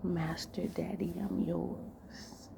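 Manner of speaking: hushed, intimate delivery with long pauses; seductive role-play. Acted. Man giving his partner intimate orders, hushed seduction.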